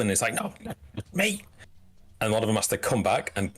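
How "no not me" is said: high, mock-panicked guard; scuffling guard's protest. Guard scuffling in retreat, high reluctant protest. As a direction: plead in a small high voice. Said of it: "you hear some voices and scuffling".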